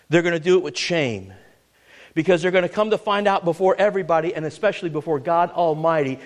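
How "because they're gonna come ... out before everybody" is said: ordinary delivery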